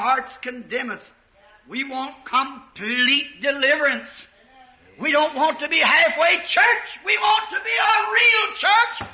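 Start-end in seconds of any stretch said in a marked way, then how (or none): 5.0-9.1 loud, higher exhortation, slowing on real church; fiery preaching. Real, not acted. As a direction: thunder it at the congregation from the pulpit. Preacher exhorting congregation at climax.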